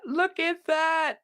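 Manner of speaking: slow, high, softened admiration; tender awe. Real, not acted. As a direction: linger on it lovingly. Delighted reaction to family photo.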